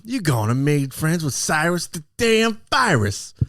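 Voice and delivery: high, loud, slow movie-villain impression; Cyrus the Virus. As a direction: draw out the villain's famous line theatrically. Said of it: "He played Cyrus the Virus".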